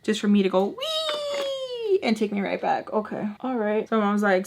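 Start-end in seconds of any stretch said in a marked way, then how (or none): 0.8-2.0 long, high, held squeal; mock gleeful "wee". Daughter mocking a pointless ride in the car, mock glee. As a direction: draw out a pretend joyride cry.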